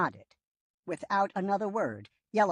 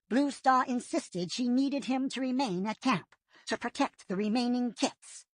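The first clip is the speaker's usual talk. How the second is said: higher, thoughtful warrior voice; lightly voiced Whitestorm; 8 semitones higher than usual. A narrator's reading. Whitestorm understanding the leader's hint, lightly higher. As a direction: realize what the leader meant.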